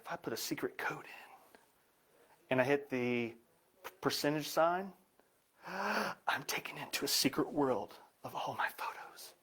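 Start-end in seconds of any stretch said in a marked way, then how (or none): 0.0-1.6 unvoiced whisper about a secret code; secretive whisper. Real, not acted. Revealing secret hidden photo vault. 5.3-9.3 gasp, then slow unvoiced whisper; mock awed whisper. Revealing secret hidden photo vault.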